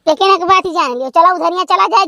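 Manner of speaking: ordinary talk, nothing marked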